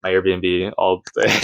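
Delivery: normal talking voice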